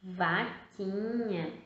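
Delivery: "Vaquinha" drawn out; slow answer. Shown as a demonstration. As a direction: say "Vaquinha" slowly. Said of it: "seria como?".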